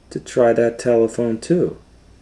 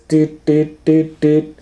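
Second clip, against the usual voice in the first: beeping signal; 8 semitones higher, slower than usual. A narrator's reading.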